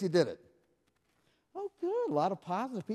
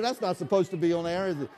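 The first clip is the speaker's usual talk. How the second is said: ordinary talk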